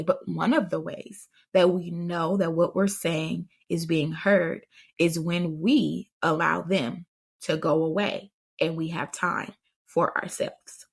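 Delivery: usual speaking voice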